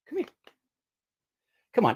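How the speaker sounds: usual speaking voice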